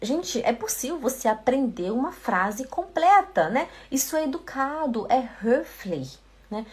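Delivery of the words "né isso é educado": ordinary talk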